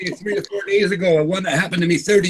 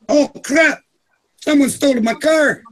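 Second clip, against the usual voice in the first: higher-pitched comic dismay; mock alarm; 7 semitones higher, 3 dB louder, slower than usual. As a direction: play a tipsy man suddenly finding his car gone. Drunk man's punchline in a joke.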